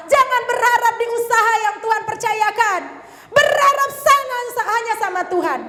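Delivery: fervent high-pitched preacher; forceful preaching. Real, not acted. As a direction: urge the congregation hard, phrase by phrase. Exhorts the congregation.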